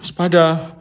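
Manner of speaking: ordinary delivery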